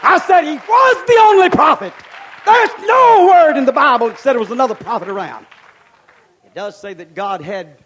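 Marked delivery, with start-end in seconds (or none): 0.0-5.5 loud, high preaching; thunderous preaching. Real, not acted. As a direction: thunder it at the tent.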